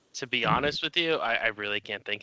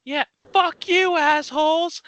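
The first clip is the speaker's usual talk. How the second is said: very high, loud, slow taunting yell; yelling back; 6 dB louder, slower than usual. Retold or imitated. Teen driver yelling back at walkers, high loud yell. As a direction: scream it out the window at them.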